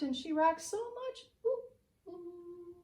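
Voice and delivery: plain reading voice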